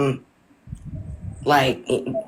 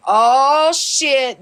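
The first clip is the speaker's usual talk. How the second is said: mock surprise; 8 semitones higher, 3 dB louder than usual. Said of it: "So if you'll say".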